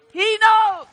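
sudden high, loud cry of "He knows"; preacher's ringing shout; shouted emphasis. Real, not acted. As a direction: lift the whole church with it. Pastor repeats climax to church.

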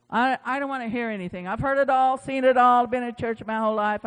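burnt-out pastor venting to himself, loud and fed up; fed-up outburst. Retold or imitated. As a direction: blurt it out like a worn-out pastor who's had enough. Burnt-out pastor's retold complaint.

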